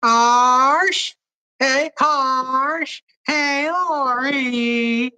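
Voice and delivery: high, stretched-out calling voice; impersonation voice. Acted. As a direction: drag each name out in a high put-on voice. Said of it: "I love all Harsh's impersonations".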